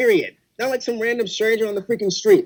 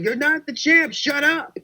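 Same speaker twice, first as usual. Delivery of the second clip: higher-pitched mock yell, no real volume; retold yell at TV; 6 semitones higher, slower than usual. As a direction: retell yelling indignantly at the television. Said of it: "I was yelling at my T V".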